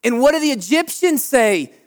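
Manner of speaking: ordinary talk, nothing marked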